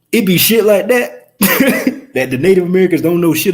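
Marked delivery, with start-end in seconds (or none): 1.4-2.1 short laugh. Real, not acted. Amused at own point.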